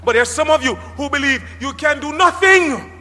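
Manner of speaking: fervent pulpit shout; preaching. Real, not acted. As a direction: call it out to the congregation. Preaching at full force.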